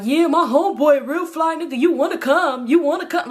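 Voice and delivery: imitating an eager boyfriend; hyped boyfriend. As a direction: push the invite, eager and loud. Enemy voiced hyping his friend.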